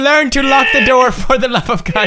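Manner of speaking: mock scolding. Scolding after walking in.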